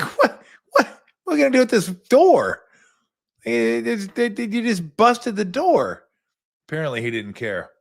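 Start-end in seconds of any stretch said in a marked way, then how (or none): 0.0-6.0 high, loud, frantic stammer at the busted door; panicked disbelief. Retold or imitated. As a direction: sputter in shocked panic, much higher and louder. Retelling panic over broken door.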